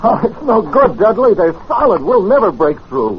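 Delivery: high, loud and hopeless; despairing panic. Acted. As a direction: cry out that it's no use. Despairing at locked brass doors.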